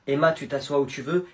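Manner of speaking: normal talking voice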